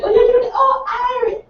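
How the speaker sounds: high, loud caricature of people assuming; mocking imitation of strangers. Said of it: "they come up to me and like".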